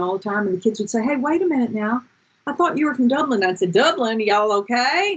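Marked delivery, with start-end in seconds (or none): 1.0-3.4 higher-pitched imitation of confused kids; puzzled pupils. Kids catching out their teacher, mock-puzzled higher voice. 3.7-5.1 higher-pitched put-on Southern twang; Southern accent. Teacher brushing off pupils' doubts, mock Southern accent. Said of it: "I'd be talking in a Southern accent".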